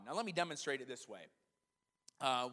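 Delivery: usual speaking voice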